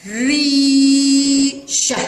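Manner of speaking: slow, sustained "ي" in ريشة; long madd vowel. Shown as a demonstration. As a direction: hold the ee in ريشة very long, then a short "sha". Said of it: "هل تسمعون هذا الصوت؟". (مدّ الياء طويلًا في ريشة)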